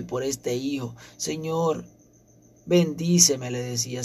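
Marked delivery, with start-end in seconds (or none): 1.2-3.5 ruego a Dios, algo más agudo; súplica citada. Retold or imitated. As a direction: imitar levemente a una mujer que clama. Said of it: "a clamarle a Dios, a pedirle". (súplica imitada)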